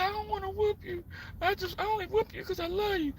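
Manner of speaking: high, soft, tearful mock mama; crying mama. Mama holding the belt, tearfully telling her kid she loves him. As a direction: plead through tears while holding the belt. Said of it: "She said".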